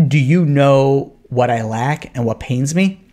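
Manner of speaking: slow, low, weighty question; really serious drunk. Acted. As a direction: play a drunk turned grave. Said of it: "like, really serious".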